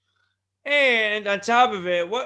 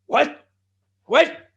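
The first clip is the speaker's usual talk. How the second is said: higher, louder double take; mock shock; 7 semitones higher, 9 dB louder than usual. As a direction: imitate a stunned old man. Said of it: "Ric Flair starts going".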